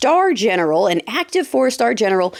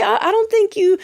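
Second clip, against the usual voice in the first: normal talking voice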